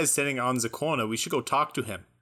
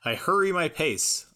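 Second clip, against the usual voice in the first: ordinary delivery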